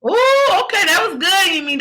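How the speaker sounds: high delighted ooh. Real, not acted. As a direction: squeal with delight at her answer. Host delighted by guest's affirmation.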